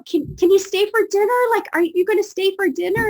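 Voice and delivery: put-on high, fast, loud pleading kid voice; eager little boys. Two little boys begging the visitors to stay, eager kid voice. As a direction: beg like an excited young boy, fast.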